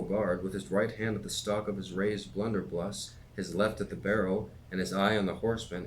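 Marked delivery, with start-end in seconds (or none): none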